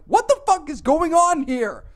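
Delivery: high, loud, drawn-out bewildered yell; exasperated shout. Retold or imitated. Customer reacting to bawling cashier, exasperated retold shout. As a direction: yell it slowly in total bafflement.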